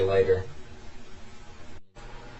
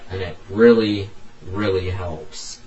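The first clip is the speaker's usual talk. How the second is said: ordinary delivery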